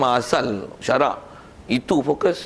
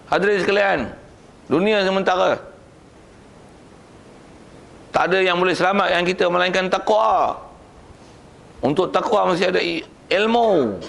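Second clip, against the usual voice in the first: high, declaimed sermon voice; firm khutbah delivery; 7 semitones higher than usual. Shown as a demonstration. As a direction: address the congregation firmly, like warning of an army. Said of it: "Rasulullah kata dia khutbah, "Ka'annahu nazirul jaish." Tegas".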